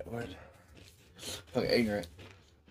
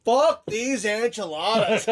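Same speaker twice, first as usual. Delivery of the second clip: mock angry outburst; 8 semitones higher, 4 dB louder, slower than usual. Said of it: "they want us to sit here and go".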